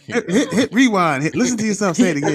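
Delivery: high, laughing, words tumbling out fast; laughing tease. Real, not acted. Co-host teasing his friend to replay what he said, laughing high and fast. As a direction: crack up while egging him on.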